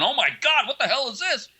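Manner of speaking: high-pitched, appalled outcry; mock horror. Other band opening the wrong CDs, imitated horrified shock. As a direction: exclaim it in comic disbelief.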